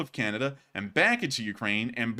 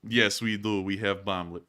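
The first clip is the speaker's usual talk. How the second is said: low, put-on Russian accent; mock Russian accent; 7 semitones lower, 4 dB quieter than usual. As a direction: drone answering in a low fake Russian accent. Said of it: "So the drone is like".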